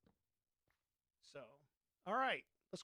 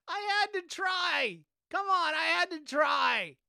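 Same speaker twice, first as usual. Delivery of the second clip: higher, louder, dragged out; exasperated pleading; 10 semitones higher, 11 dB louder than usual. Real, not acted. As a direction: beg for some sympathy. Pleads after a failed move.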